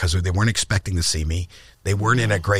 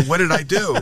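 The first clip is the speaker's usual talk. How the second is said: higher, mildly panicked question; bewildered terror; 7 semitones higher than usual. Retold or imitated. Comic asking the club owner, in higher mock alarm. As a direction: retell the frightened disbelief lightly. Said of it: "I was just terrified".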